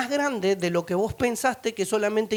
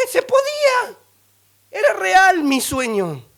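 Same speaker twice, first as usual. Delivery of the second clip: high, loud cry of amazed discovery; asombro eufórico; 10 semitones higher, 7 dB louder, slower than usual. Retold or imitated. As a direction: exclaim it high and loud, stretching it out. Reliving the discovery of his dream. (asombro eufórico, a viva voz)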